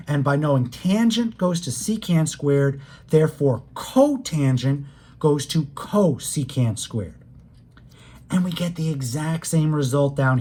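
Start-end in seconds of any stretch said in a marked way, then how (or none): 3.7-6.8 CO punched in both "cotangent" and "cosecant"; stress on CO. Shown as a demonstration. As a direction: lean on each "co" in "cotangent" and "cosecant". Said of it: "hear me emphasizing the word co".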